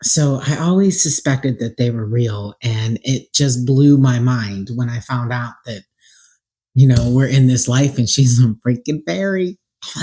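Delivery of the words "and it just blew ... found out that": ordinary talk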